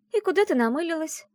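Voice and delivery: high, teasing query; amused question. A narrator's reading. Friend watching heroine rush off, amused teasing. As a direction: ask it with a hint of a chuckle. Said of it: "со смешком спросила подруга".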